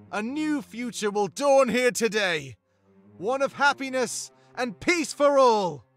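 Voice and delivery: high, projected leader's address; rally the troops before battle; rallying battle speech. Acted. Leader rallies army before battle.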